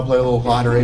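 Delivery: ordinary talk, nothing marked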